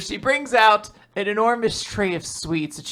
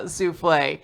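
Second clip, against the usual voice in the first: usual speaking voice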